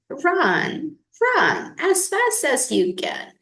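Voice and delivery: high playful taunt; taunting gingerbread man. A narrator's reading. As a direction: tease them from afar. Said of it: "just laughed and said".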